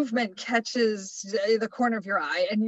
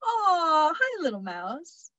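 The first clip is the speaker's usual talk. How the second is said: tender cooing; 7 semitones higher, slower than usual. Pretend. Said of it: "reactions that range from".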